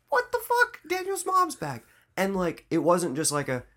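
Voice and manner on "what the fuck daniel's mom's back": high, stunned squeal of disbelief; mock shock. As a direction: gasp it like the twist just hit. Shocked fan reaction, retold.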